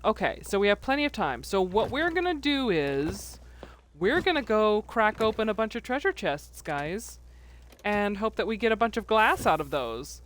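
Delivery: normal talking voice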